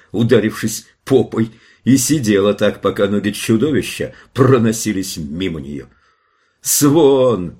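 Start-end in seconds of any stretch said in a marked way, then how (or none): 6.6-7.6 loud, high, drawn-out cry of a name; shouting. A narrator's reading. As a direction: shout for her in alarm. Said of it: "закричал Джош". (громкий тревожный крик)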